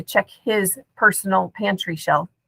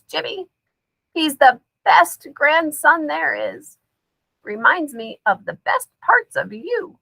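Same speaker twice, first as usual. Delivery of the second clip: G-Ma's voice; 6 semitones higher than usual. A narrator's reading. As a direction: coax like a possessed grandmother. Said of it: "in G-Ma's voice".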